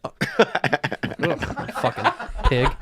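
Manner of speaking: hushed, halting mutter of contempt; imitated disgust. Passengers blaming each other in the aisle, mock disgust. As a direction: act out a disgusted aside under the breath. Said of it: "they go like this".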